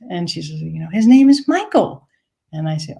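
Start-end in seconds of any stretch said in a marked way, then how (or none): 0.6-2.1 high, falling proud announcement; high proud old lady. Retold or imitated. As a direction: announce it like a proud elderly mother. Woman's voice retold.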